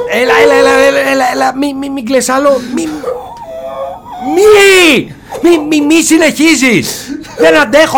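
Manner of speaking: high, rushed pleas rising into a laughing shout; desperate pleading. Acted. Begging the wailing creature to stop, frantic. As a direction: plead frantically over the crying.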